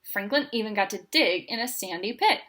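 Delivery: plain reading voice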